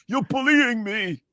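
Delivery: high, slow mock protest; mock victim complaint. Co-host mocking the upset opponent as a high-voiced victim. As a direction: play the bullied victim, drawn out.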